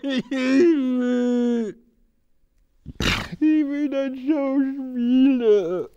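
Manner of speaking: slow, anguished pleading; tormented man. Acted. Haunted man pleading that he must do it. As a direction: fight the inner compulsion. Holds the tormented role to its end.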